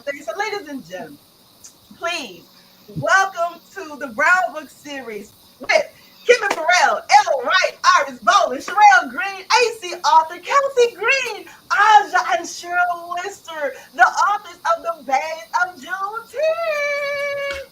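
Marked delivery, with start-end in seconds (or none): 6.3-17.7 announcing the guest authors with showman flair; announcer roll call. Acted. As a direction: call each name out like a stage host.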